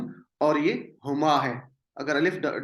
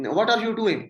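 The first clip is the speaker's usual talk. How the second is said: quoted question; 7 semitones higher, 4 dB louder, much slower than usual. Shown as a demonstration. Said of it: "آپ کسی سے پوچھتے ہیں کہ".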